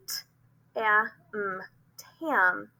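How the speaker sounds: t, a, m said apart then blended as tam; blending demo. Shown as a demonstration. Teacher to parents, sounding out a word. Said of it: "example here would be".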